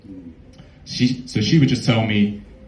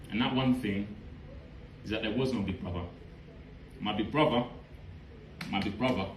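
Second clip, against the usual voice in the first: softer, choked with real emotion; emotional; 9 dB quieter than usual. Real, not acted. Poet revealing he was his own brother, softer and choked. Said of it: "so emotional".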